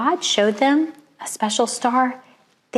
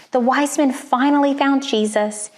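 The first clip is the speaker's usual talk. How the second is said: ordinary delivery, nothing marked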